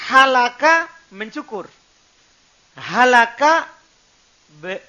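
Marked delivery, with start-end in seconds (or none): 0.1-0.9 Halaka with a loud breathy middle-throat h. Shown as a demonstration. Teacher contrasting words for students, loud breathy h. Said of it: "Harus beda". 2.8-3.7 Halaka with a loud breathy middle-throat h. Shown as a demonstration. Teacher contrasting words for students, loud breathy h. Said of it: "Harus beda".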